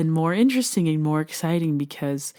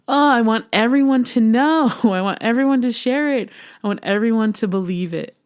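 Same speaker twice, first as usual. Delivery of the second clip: high, loud, gushing plea with a laugh; bubbling over with eagerness; excited outburst; 5 semitones higher, 5 dB louder than usual. Real, not acted. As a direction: gush it out, higher and louder, easing toward the end. Gushing wish to share her message.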